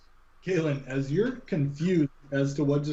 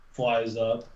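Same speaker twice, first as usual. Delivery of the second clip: usual speaking voice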